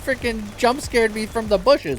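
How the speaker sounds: flustered high complaint. Real, not acted. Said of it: "I wouldn't really call that a jump scare".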